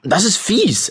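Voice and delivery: put-on man protesting to the boys; indignant. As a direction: sound wronged. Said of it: "empörte sich Will".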